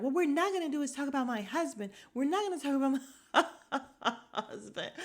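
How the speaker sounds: mock-offended, breaking into laughter; imitating indignant wife. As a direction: lay down the law, then crack up. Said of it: "then she's like".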